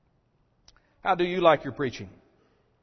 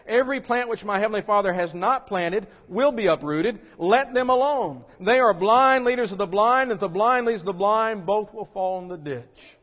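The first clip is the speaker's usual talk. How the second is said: loud, raised preaching of scripture; preaching at full force; 5 semitones higher, 5 dB louder than usual. Acted. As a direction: proclaim it to the crowd. Preaching Jesus' words forcefully.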